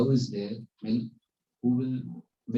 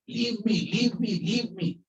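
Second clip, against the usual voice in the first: high-pitched mock cries for release; imitated shouting; 12 semitones higher, 3 dB louder, slower than usual. Guru clinging to a tree, mock shouting for release. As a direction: plead loudly, acting out someone trapped. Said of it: "he started shouting".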